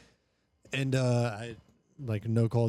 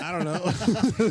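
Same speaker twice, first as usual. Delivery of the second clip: high, drawn out through laughter; laughing shrug; 5 semitones higher, much slower than usual. Retold or imitated. Teen answering mom about quitting, laughing helplessly.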